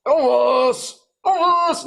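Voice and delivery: very high, loud drawn-out howl. Pretend. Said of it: "He does his impersonation of [name]".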